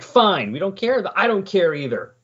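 mock teenage huff, pitched high; childish. Podcaster apes the sulking hero who claims not to care, childish huff. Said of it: "you're being so childish about it".

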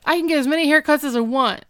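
loud, high, hurried protest; defensive. Retold or imitated. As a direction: insist on it defensively. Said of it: "gets very defensive and weird".

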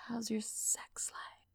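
soft breathy whisper, drawn out. Acted. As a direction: whisper it like a secret. Host asks listeners intimately.

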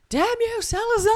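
high, loud mock shout; hammed-up villain-cursing cry. Mock curse shouted in jest.

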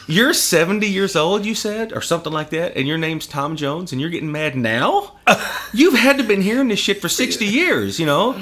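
loud, amused ribbing; mock disbelief, laughing; laughing taunt. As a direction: tease him while you're cracking up. Retelling teasing of [name].

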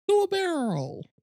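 very high cartoon sidekick squeak; squeaky game quote. Imagined line at the trailer's end.

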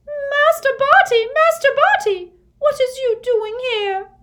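very high, loud house-elf squeal; piercing shriek. A narrator's reading. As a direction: shriek in horror like a tiny frantic servant. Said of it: "she let out a piercing shriek".

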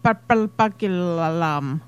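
slow slurred Scottish "Purple burglar alarm"; Scottish accent. Shown as a demonstration. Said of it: "Try and say it with a Scottish accent".

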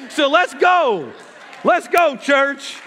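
high, loud rallying call; rousing shout. Real, not acted. Preacher rallying the church to go, rousing shout. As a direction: fire up the room.

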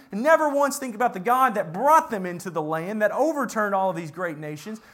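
ordinary talk

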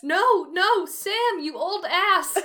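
loud, high hammed-up cry of protest; exclaimed protest. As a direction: call out in playful mock alarm. Reading Frodo's cry to Sam aloud.

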